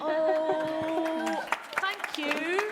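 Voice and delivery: high, loud burst of thanks; delighted. Real, not acted. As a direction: gush with delight at the rescue. Thanks someone for warming help.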